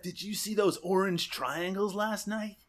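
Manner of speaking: slow, soft stoner drawl; spaced-out hippie stoner. Retold or imitated. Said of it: "one of them says".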